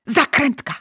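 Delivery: plain reading voice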